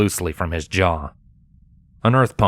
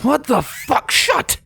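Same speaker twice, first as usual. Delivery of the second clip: much higher, shocked outburst; slower than usual. A narrator's reading. Comrade guard shocked seeing the intruder, yelping much higher. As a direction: yelp it in wide-eyed shock. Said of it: "eyes wide with surprise".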